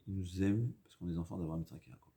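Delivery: ordinary talk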